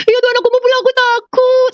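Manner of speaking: put-on frightened child's high wail; like a small child. Telling God she is afraid, like a small child. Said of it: "kayak anak kecil di hadapan Tuhan".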